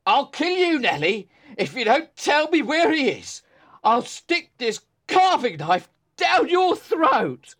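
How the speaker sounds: put-on drunken threat. A narrator's reading. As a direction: threaten her with the knife, shouting. Drunk father threatens with knife.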